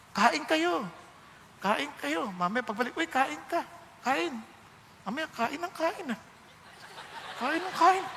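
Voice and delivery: sarcastic "kain ng kain", softly mocking; grudging host. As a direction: imitate a host who resents the guests eating. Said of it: "Nag-iba na yung tono".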